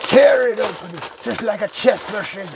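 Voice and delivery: high, loud showman's hype; excited hype. Pretend. Host hyping the cereal box opening, high excited shout. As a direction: belt it out high to launch the opening.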